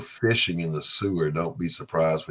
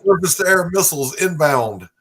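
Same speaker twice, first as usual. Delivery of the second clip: mock military radio call; 10 semitones higher, 6 dB louder than usual. Joking plane crash call.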